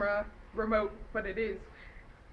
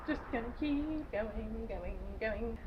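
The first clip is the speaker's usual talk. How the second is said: slowed way down, fading softer; slow fading drone; 6 dB quieter, much slower than usual. Shown as a demonstration. Moving the recap along, a slow fading drone. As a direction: let it drift off slowly into nothing.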